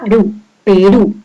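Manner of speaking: Perú, said pe-RÚ after a lone ru; stress on last syllable. Shown as a demonstration. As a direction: isolate ru, then Perú stressed on RÚ. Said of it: "la mayor fuerza de voz".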